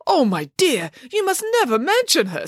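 high, dramatic distress; theatrical high-pitched horror; shocked dismay. A narrator's reading. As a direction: warn them in scandalised dismay. Nanny shocked at painful name.

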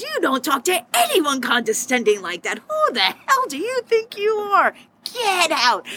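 an outraged bar owner defending her staff; furious kicking out. Retold or imitated. Owner throws customer out of bar.

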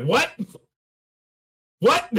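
high, rising shocked squeak; mock disbelief. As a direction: pop the question up in amazement. Acted amazed reaction.

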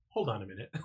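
a quiet aside, mid-confession; offhand interruption. Retold or imitated. Girlfriend interrupts to order pizza.

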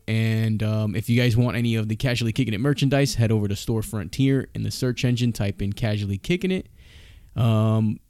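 ordinary delivery

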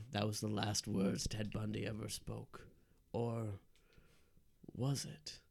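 soft, hushed campfire-tale voice; spooky story narration. Acted. Narrator telling a scary Ted Bundy story, hushed suspense. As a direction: draw them in quietly with mock suspense.

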